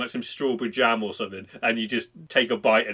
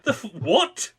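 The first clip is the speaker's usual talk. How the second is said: baffled; 8 semitones higher, slower than usual. Retold or imitated. Confused viewer now.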